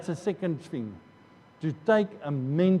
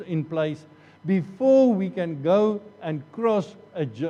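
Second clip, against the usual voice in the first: ordinary delivery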